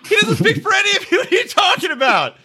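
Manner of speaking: comic high-pitched outrage; exasperated outburst. Acted. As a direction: shout down a silly opinion, half laughing. Acts out outraged reply to tweets.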